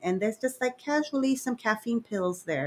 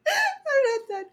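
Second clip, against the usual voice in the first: squeaky high laugh-crying; trying to talk through a laughing fit; laughing hard; 14 semitones higher, 4 dB louder than usual. Real, not acted. Laughing fit while telling story.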